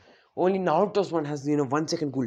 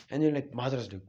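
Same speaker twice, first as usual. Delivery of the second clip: plain reading voice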